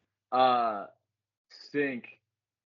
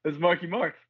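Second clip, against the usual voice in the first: starstruck; 8 semitones higher, 6 dB louder than usual. Retold or imitated. Starstruck seeing celebrity courtside.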